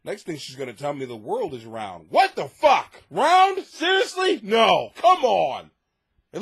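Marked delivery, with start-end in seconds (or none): 2.1-5.7 loud, high fake disbelief; mock outrage. Joking about the round world, loud sarcastic protest. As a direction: scoff as if insulted.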